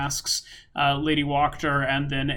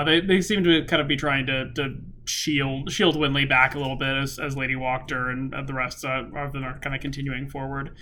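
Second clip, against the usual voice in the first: ordinary talk, nothing marked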